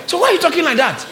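high-pitched exasperated reproach; mock scolding. Scolding the complainers.

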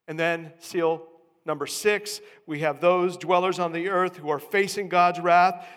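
loud, building sermon delivery; full-force preaching. Real, not acted. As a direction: pile up the examples with mounting volume.

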